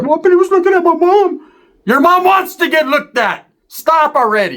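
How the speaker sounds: very high, whiny mock voice; whiny Ricky. Ricky whining that the homie eyed his mom. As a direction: whine it like a hurt kid.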